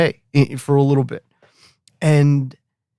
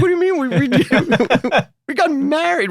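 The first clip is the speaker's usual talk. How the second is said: high-pitched incredulous wife voice; imitating his wife; 12 semitones higher than usual. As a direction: pitch up to mimic her disbelief. Wife voiced in retold argument.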